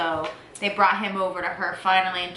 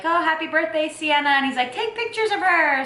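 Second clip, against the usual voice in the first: cheerful doctor; 8 semitones higher than usual. Retold or imitated. Doctor voiced in birth story.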